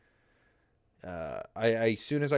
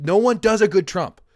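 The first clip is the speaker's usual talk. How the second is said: louder, higher, punching every word; emphatic declaration; 9 semitones higher, 10 dB louder than usual. Real, not acted. Ranting about Trump impressions, loud insistence. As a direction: insist loudly that nobody can. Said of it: "repeated emphatic".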